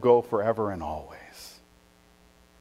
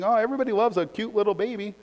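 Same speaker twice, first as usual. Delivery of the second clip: mock cooing; 9 semitones higher, faster than usual.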